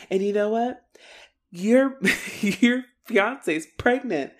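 high, slow dramatic reveal; mock defiant announcement. Emma blurting it out to Snake, mock defiant announcement.

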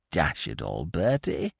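lightly voiced querulous friend. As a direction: grumble at Bertie's fuss. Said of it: "said young Bingo querulously".

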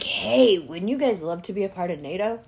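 wheedling American government. Retold or imitated. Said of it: "government in America is like".

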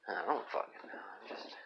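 whispered, trailing off. Real, not acted. Whispering to his listeners, worn out. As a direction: let it fade into a whisper.

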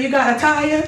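high sweet mock killer ask; a fake-friendly stranded killer. Killer luring a driver, high fake-friendly ask. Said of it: "what the killer say".

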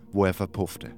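ordinary delivery, nothing marked

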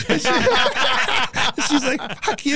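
high-pitched words through real laughter; laughing too hard to tell it straight; laughing retelling. Real, not acted. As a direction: crack up while quoting the retort. Laughing through wife's retort.

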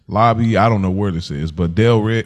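usual speaking voice

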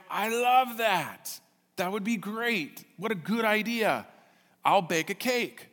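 a lightly voiced restaurant owner, thrilled; delighted owner. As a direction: savour each word like a great idea. Owner delighted by the party idea.

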